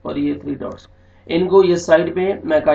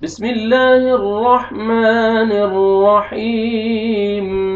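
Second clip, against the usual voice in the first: slow, high, louder chanted tilawat; Quran recitation; 5 semitones higher, 6 dB louder, much slower than usual. Shown as a demonstration. Teacher reciting the surah from the start, in slow melodic tilawat. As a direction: open with Bismillah in a long melodic line. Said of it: "میں سورہ پڑھتا ہوں".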